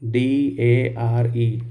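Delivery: letters spelled one by one, very slowly; spelling the word for students. Shown as a demonstration. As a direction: dictate each letter slowly. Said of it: "जैसे मैंने लिखा dare".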